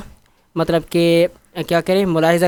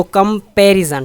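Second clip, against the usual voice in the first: Comparison slow and loud, पै stressed; stress on second syllable; 2 semitones higher, 4 dB louder, much slower than usual. Shown as a demonstration. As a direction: hit the पै in comparison. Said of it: "पे पे जोर है".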